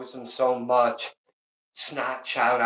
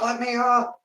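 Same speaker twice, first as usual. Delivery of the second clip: high mock cry to be let up; whiny pinned boyfriend; playfully pleading to get up; mock plea; 10 semitones higher than usual. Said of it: "the lover's like".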